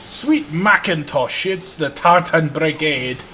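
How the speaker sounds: slow, hammy Scottish engine voice; drawn-out mock Highland exclamation; Scottish accent. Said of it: "said Ivor".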